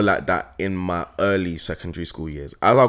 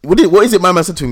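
excited; 8 semitones higher, 8 dB louder, faster than usual. Real, not acted. Excited retelling of a story.

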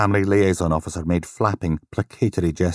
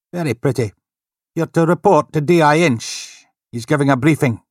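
polite desk sergeant, a bit higher; lightly voiced desk sergeant; 9 semitones higher, 6 dB louder than usual. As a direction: greet him with polite formality.

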